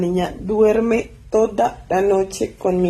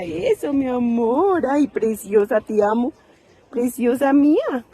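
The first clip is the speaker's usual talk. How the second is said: higher, doting coo; 5 semitones higher than usual. Real, not acted. Owner greeting and praising her dog, higher doting coo.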